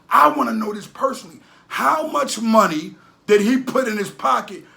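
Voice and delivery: loud, insistent questioning; loud exasperated demand. Real, not acted. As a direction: demand an answer, hammering it home. Demanding an answer from a rapper.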